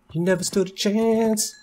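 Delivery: high, silly victory taunt; mock gloating. Player gloats over beaten enemy, hammed-up triumph. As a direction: crow over a beaten foe in a put-on high voice.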